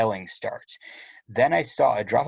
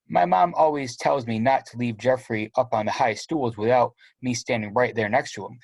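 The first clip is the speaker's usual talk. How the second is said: plain reading voice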